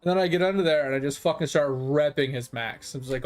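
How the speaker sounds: ordinary talk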